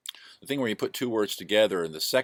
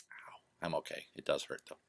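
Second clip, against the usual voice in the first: pained whisper; 3 semitones lower, 10 dB quieter than usual. Real, not acted. Toe still hurts, whispered.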